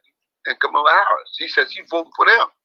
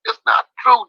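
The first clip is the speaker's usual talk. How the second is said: insistent rebuttal; 7 semitones higher, 5 dB louder than usual. Retold or imitated. Acts out rebuttal to Trump believers.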